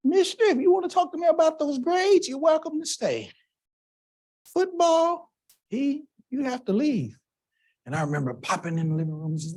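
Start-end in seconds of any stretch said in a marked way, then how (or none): 0.0-7.2 high, firm mother telling the coaches off; mother's firm voice. Retold or imitated. Mom telling the athletic director to leave, firm and high-pitched. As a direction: voice mom laying down the law, pitched up. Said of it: "I still hear her voice".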